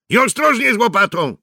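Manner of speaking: calling out. Acted. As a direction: call across to the diggers. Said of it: "zawołał Mikke".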